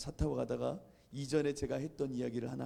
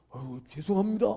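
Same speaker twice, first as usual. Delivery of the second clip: flustered apology; 3 semitones higher, 8 dB louder than usual. Retold or imitated. Stranger apologises and hurries out.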